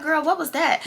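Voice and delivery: put-on girl what was that with a falling, puzzled tune; disbelieving question to a friend; baffled girl what. Shown as a demonstration. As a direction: ask it half laughing in confusion. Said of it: "I just said it just like that".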